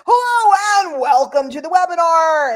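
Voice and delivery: high, loud cheesy host voice; Guy Smiley host voice. Pretend. Mocking presenters who think they must be loud and cheesy, Guy Smiley host voice. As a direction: greet the webinar like a game show host. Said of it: "be Guy Smiley".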